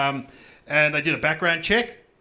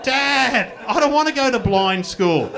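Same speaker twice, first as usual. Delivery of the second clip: put-on whiny kid; 6 semitones higher, 7 dB louder, slower than usual. Child whining.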